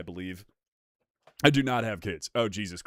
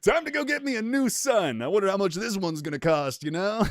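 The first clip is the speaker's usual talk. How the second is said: playboy; 7 semitones higher than usual. Retold or imitated. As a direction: play a pleased billionaire shopping. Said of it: "billionaire playboy".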